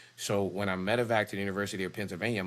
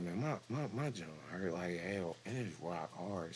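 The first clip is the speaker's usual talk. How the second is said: hushed complaint; 10 dB quieter than usual. Retold or imitated.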